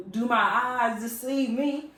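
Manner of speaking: slow, high, loud theatrical disbelief; mock astonishment. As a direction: draw it out in hammed-up amazement. Acting her astonished first sighting.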